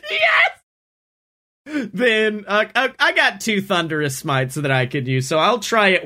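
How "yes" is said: excited shout. Real, not acted. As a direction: cheer it out at the top of your voice. Player's attack set up works.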